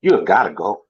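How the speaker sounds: stern parent confronting his son, pitch swinging with disbelief; angry, heartbroken father. Acted. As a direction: act out a father's hurt outrage, punching key words. Acted audition monologue, father confronts son.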